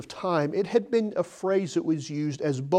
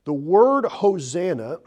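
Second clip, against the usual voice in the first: usual speaking voice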